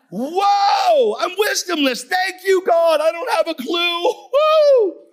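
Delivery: very high, loud shrieking celebration; ecstatic yelling. Retold or imitated. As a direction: scream it like a lunatic alone in the room. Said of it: "I'm yelling for, like, thirty-five minutes".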